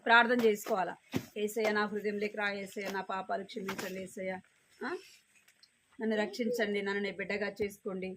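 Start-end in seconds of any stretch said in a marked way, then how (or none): 1.4-8.1 soft, hushed prayer; prayer example. Shown as a demonstration. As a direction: pray it gently for the children to copy. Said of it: "అని అడగాలి". (పిల్లలకు చూపిస్తూ మెల్లగా ప్రార్థన)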